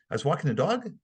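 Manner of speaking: rising tone on "dog", like a question; statement turned into a question by a rise on "dog"; questioning inflection. Shown as a demonstration. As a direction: lift the end on "dog" as if unsure. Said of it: "I had the inflection of a question".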